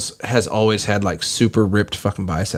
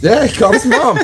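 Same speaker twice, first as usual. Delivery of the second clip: laughing; 12 semitones higher, 4 dB louder than usual. Real, not acted. Laughing while repeating joke.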